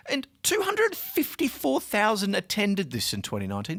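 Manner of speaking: normal talking voice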